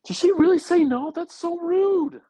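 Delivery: high, loud shocked outrage; can't believe she said no; outraged disbelief. Real, not acted. Outraged at sister.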